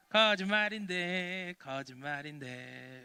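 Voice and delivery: slow sing-song taunt, sinking at the repeat; teasing prankster. Acted. Boy playing a prank on villagers, teasing sing-song. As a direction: draw it out like a naughty child's tease. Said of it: "장난을 치는 거예요".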